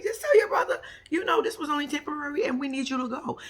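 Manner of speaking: high, laughing through the words; can't stop laughing while advising him; laughing while talking. Real, not acted. Host laughing at the brother.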